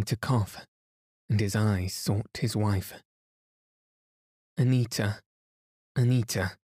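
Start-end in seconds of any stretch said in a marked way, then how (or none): 4.6-6.6 slightly raised voice, calling a name; mildly loud call. A narrator's reading. Sick painter seeking his wife, calling out mildly loud. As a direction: call out with understated loudness. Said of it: "he said loudly".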